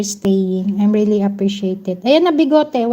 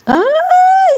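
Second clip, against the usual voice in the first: much higher, louder gasp of surprise; surprised shriek; 5 dB louder than usual. Real, not acted. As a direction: yelp as if startled by the news. Host surprised at a name.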